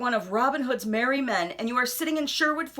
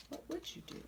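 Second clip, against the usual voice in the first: unvoiced, very soft whisper; 5 semitones higher, 19 dB quieter, faster than usual. Asking the young listener in a whisper. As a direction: lean in and whisper the question.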